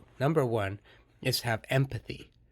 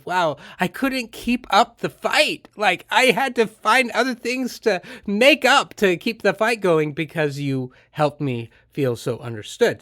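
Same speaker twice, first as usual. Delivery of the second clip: higher and louder, marvelling; planted client telling the intern, higher and louder in amazement; amazed admission; 7 semitones higher, 7 dB louder than usual. Retold or imitated. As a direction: gush that the fight fell apart. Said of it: "And they said".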